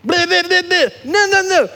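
high-pitched blocking-out babble; mock screaming. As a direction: chant it high, like drowning out someone talking. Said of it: "with their fingers in their ears and screaming".

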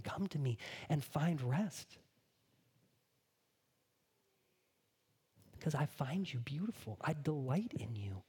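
gently insist, then whisper; Jesus lovingly inviting the real you; slow tender Jesus. Acted. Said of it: "And Jesus says".

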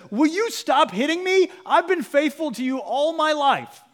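high, loud, wounded donkey pleading; the donkey scolding its rider, high and loud; hurt talking donkey. Retold or imitated. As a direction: whine it high like a betrayed donkey. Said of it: "He literally says".

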